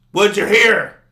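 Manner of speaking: loud, high mock retort; cranky old man. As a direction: throw it back like a crabby old guy. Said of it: "he's just an old, cranky".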